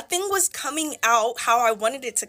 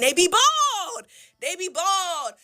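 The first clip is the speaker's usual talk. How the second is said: gleeful reveal; 7 semitones higher, 4 dB louder, much slower than usual. Real, not acted. Exposing a wig wearer.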